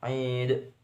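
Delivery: "Aid" drawn out, ending in a bounced d; qalqalah kubra. Shown as a demonstration. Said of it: "bacaan qalqalah kubro". (baca "Aid" dengan pantulan dal di akhir)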